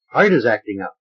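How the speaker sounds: usual speaking voice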